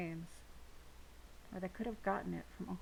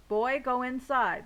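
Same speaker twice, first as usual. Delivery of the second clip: slow, loud, higher command; stern order; 5 semitones higher, 10 dB louder, slower than usual. A narrator's reading. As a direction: bark it slowly at the boy. Cop orders boy inside.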